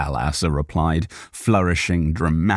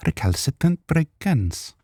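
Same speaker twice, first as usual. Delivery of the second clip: put-on Welsh accent; 7 semitones higher than usual. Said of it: "I'm from Wales".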